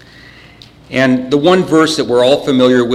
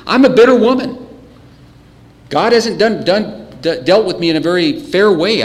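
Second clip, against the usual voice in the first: put-on higher, louder complaint of a bitter old woman; 6 semitones higher, 4 dB louder than usual. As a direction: voice Naomi's bitter grievance against God. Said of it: "She's bitter, she's complaining".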